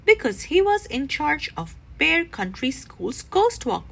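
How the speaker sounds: ordinary delivery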